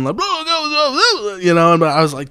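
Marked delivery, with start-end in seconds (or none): 0.2-1.3 mock garbled yelling. Man storms out of the restaurant, mock garbled yelling. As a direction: imitate someone storming out yelling gibberish. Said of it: "yelling something".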